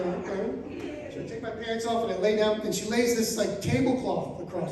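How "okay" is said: meek okay. Retold or imitated. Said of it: "I go".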